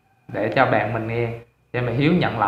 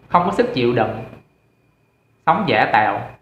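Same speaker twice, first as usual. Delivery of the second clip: scolding friend. Acted. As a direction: lay into a friend with contemptuous reproach.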